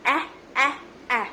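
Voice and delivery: ए as the open 'a' of apple; phonics sound. Shown as a demonstration. Mother teaching phonics, isolated short 'a' sounds for ए. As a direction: say each ए separately as the short a in apple. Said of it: "ए नहीं है उसका phonic sound".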